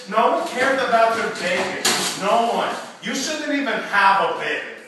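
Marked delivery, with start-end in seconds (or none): none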